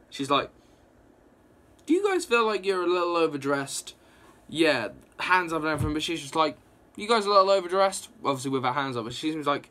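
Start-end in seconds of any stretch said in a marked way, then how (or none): 1.8-3.9 lightly voiced cocky woman; a hint of a cheeky, unbothered captive. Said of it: "a bit of a cocky attitude". 6.9-8.1 fast, higher and louder smug tease; mock-cocky quip to the soldiers. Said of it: "a bit of a cocky attitude".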